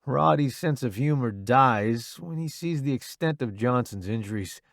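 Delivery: ordinary delivery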